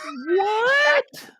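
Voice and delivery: high-pitched hammed-up shriek of disbelief; exaggerated imitation of a stunned outcry; mock shock. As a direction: shout it way up high, drawn out. Acts out character's shocked reaction.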